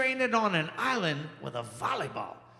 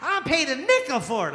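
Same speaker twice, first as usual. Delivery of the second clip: high proud mom showing off a nickel bargain; mama voice, pitched way up and delighted; 7 semitones higher than usual. Retold or imitated. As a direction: play his mama bragging about a yard-sale find. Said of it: "She used to bring up stuff".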